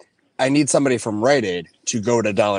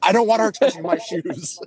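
panicked; 9 semitones higher than usual. Retold or imitated. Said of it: "the panic and almost tears".

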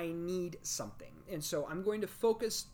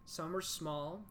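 guided meditation. Acted. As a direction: guide them gently into calm.

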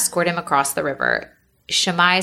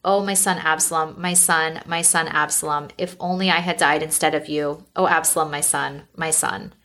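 usual speaking voice

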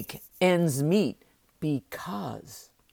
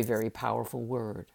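ordinary talk, nothing marked

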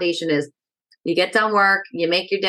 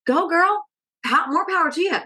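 cheering, high pitched; 9 semitones higher than usual. Real, not acted. Cheering on early risers.

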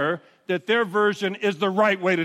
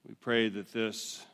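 prayer; 8 semitones lower than usual. Acted. Pastor leads prayer.

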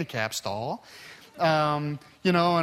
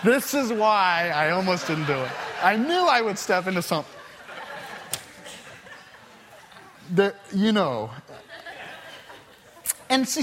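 laughing; 3 semitones higher than usual. Real, not acted. Speaker laughs at his own slip.

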